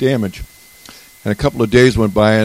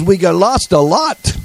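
slow, higher, imitated French accent; a light French accent, drawn out; 10 semitones higher, 4 dB louder, slower than usual. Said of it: "with a French accent".

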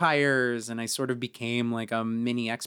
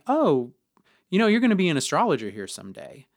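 higher, louder, fast and breezy; breezy prediction; 7 semitones higher, 8 dB louder, faster than usual. Retold or imitated. Boss tells new hire his future, breezy and fast. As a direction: toss off the prophecy like small talk.

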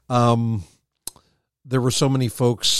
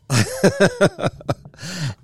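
laughing; 6 semitones higher than usual. Real, not acted. Host laughs at joke.

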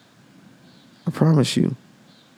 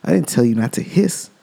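scolding monk; 6 semitones higher than usual. Retold or imitated. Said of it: "looks at the snake and says".

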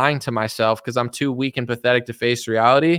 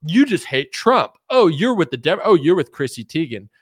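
mocking whiny accuser; 3 semitones higher, 4 dB louder than usual. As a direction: taunt like a petulant child. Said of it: "you sound like a, like a pathetic child".

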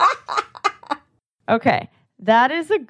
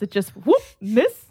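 normal talking voice

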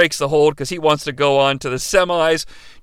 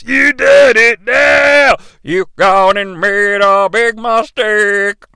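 slow, drawn-out mock shout, easing off; hammed-up wrestler threat; 3 semitones higher, 4 dB louder, much slower than usual. Quoting a threat while recapping the wrestlers, slow mock shout. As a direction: drag out every word like a taunting wrestler.

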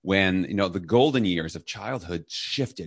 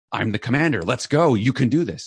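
commander voice; 5 semitones higher, 6 dB louder than usual. Retold or imitated. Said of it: "But I'm in charge".

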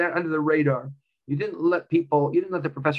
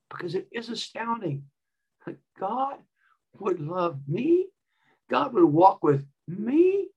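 hushed awe; 5 semitones higher than usual. Real, not acted. Preacher marvels at God's love.

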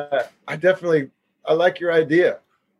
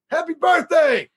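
excited shout; 12 semitones higher than usual. Pretend. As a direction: belt it out like a celebration. Birthday wish requested by fan.

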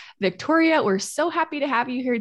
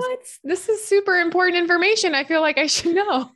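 higher, louder playful disbelief; mock surprise; 9 semitones higher, 4 dB louder than usual. Real, not acted. Host teasing guest about her Swiss origin, playful surprise. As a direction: act amazed at the news, teasing.

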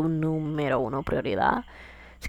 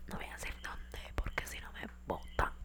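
secretive unvoiced whisper; 20 dB quieter than usual. Real, not acted. Whispering about her job to listeners, secretively. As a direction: whisper so the boss won't hear.

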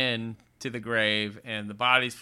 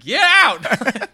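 loud, higher yell of get out; mock yell; 10 semitones higher, 10 dB louder than usual. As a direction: shout her out of the store. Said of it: "I said".

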